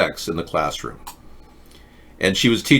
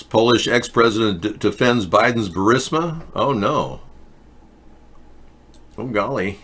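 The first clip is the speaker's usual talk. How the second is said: usual speaking voice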